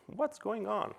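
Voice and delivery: sudden high-pitched what's going on; a developer aghast at his own mess; dismayed surprise. Retold or imitated. Developer staring at his messy code base, aghast high-pitched question.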